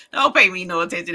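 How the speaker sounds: ordinary talk, nothing marked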